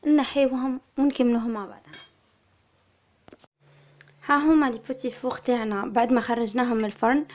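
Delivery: ordinary talk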